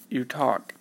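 usual speaking voice

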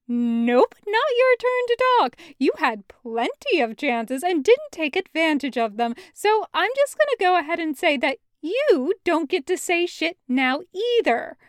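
sharp, loud and high, cutting him off; furious interruption; angry. Acted. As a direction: shut him down hard. Loop angrily cutting Kravitz off.

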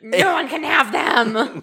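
loud, slow gremlin snarl; angry gremlin voice. Acted. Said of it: "my angry gremlin voice".

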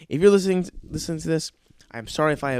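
usual speaking voice

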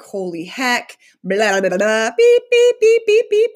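squeaky censor beeps over a mock cussing fit; excited mock cussing. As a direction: play an excited rant that gets censored. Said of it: "I get excited".

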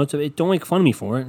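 ordinary delivery

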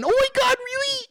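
high squealed mock amazement; hammed-up overjoyed surprise; surprised delight. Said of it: "the surprise yes gets you all".

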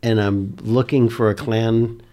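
loud, projected parley; non-alarming but audible. Acted. Player declaring peaceful intent from the treetop, loud measured hail. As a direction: call down firmly so it carries. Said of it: "so I'm gonna say".

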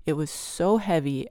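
plain reading voice

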